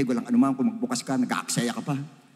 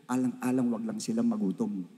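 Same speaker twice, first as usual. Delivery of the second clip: normal talking voice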